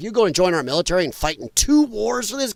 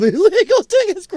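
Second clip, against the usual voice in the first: laughing; 7 semitones higher, 4 dB louder than usual. Real, not acted. Laughing while reading chat comment.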